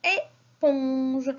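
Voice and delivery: "éponge" slowly split into "é" and "ponge"; teacher sounding out "éponge" for children; syllable by syllable. Shown as a demonstration. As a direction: stretch "éponge" so each syllable is heard. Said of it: "la syllabe que j'entends".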